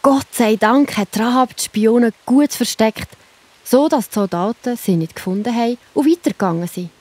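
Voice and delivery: usual speaking voice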